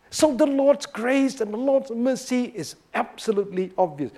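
normal talking voice

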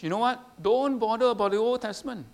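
lightly mimicking the dismissive teacher; mock false teacher.

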